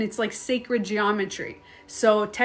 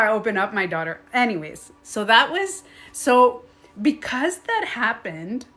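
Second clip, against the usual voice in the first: ordinary talk, nothing marked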